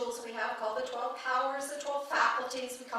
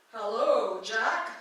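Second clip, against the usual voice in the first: louder voice from above, in the speaker's own register; usual heavenly voice; 4 dB louder, much slower than usual. Acted. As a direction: call down as a voice from the sky. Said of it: "And usually it's a".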